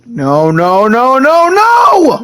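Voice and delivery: rising denial. Pretend. Player echoing Sylvain's grief over his dead brother, rising denial. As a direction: start stunned, then break into protest.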